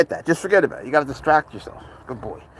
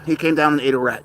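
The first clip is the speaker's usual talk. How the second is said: normal talking voice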